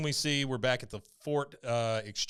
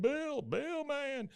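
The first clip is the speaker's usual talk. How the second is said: very high, soft, drawn-out cry; hammed-up disbelief at losing a friend; mock distraught wail; 5 dB quieter, much slower than usual. Guys watch Bill's tank blow up, high plaintive wail.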